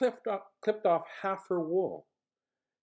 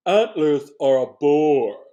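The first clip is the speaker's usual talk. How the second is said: lion's voice; 4 semitones higher, 13 dB louder than usual. Acted. Lion brags about his mane to the animals, loud. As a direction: boast about the golden mane loudly. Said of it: "he said".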